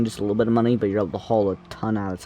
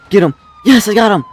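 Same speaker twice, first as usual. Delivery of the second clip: loud triumphant yell; excited shout; 10 semitones higher, 11 dB louder, faster than usual. Real, not acted. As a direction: cheer it out loud. Catching the target in game.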